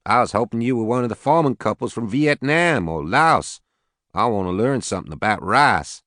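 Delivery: lightly voiced Southern farmer; southern accent. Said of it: "He had a heavy southern accent".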